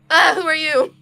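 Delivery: high, loud yelp at strangers; hammed-up shocked shout; startled cry. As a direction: recoil and demand in alarm. Startled character sees strangers.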